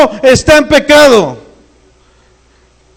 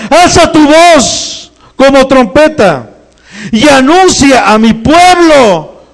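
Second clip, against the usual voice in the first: fiery preaching; 4 semitones higher than usual. Acted. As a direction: proclaim it like a trumpet call to the church. Preaching at full force.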